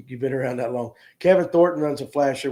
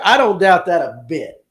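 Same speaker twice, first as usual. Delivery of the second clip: ordinary talk, nothing marked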